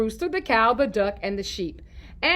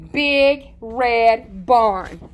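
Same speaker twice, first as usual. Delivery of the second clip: slow, drawn-out title read; title read slowly; 5 dB louder, much slower than usual. Shown as a demonstration. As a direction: announce the story title very slowly. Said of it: "go right on into our story".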